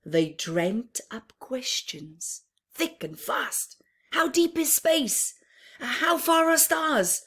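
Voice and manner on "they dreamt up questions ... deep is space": plain reading voice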